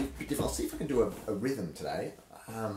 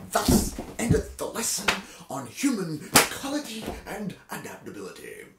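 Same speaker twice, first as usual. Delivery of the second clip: grand proclamation; 11 semitones higher, 9 dB louder than usual. Shown as a demonstration. Mock-solemn close of reading.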